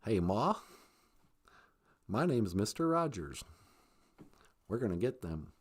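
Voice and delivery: very low, soft George Bush-like voice; hushed low impersonation; Mister Rogers. Acted. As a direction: drop deep and quiet, like George Bush. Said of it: "Mister Rogers was kind of like, uh, um, George Bush".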